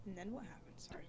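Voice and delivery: hushed teasing whisper. Real, not acted. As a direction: breathe the question softly.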